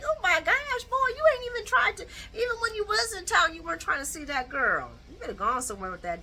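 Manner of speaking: high-pitched scolding reaction. Retold or imitated. Viewer scolding Drew through the screen, high and sassy. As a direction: scold him like an outraged auntie. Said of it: "I was like".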